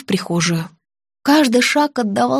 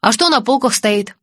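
plain reading voice

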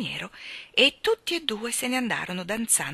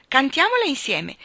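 eager; 7 semitones higher, 7 dB louder than usual. Acted. Jester eagerly invites singing.

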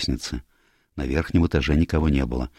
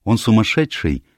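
higher, mildly urgent questioning; sharp cry; 6 semitones higher than usual. A narrator's reading. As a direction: demand an answer with a touch of alarm. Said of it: "резко вскрикнула Диана".

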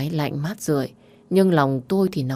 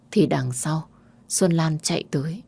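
usual speaking voice

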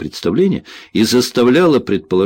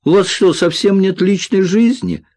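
high, complaining whine; plaintive question pitched well up; whining; 10 semitones higher than usual. A narrator's reading. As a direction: moan at an overzealous colleague. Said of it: "заныл Винни".

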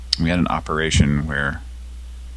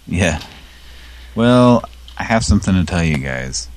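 ordinary talk, nothing marked

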